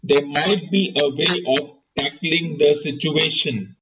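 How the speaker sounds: plain reading voice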